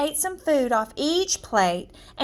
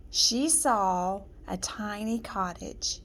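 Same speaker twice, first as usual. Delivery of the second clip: slow storybook whisper; slow, secretive whispered narration; 5 semitones lower, 7 dB quieter than usual. A narrator's reading. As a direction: hush it as if afraid to be heard. Narrating a hushed, creeping scene.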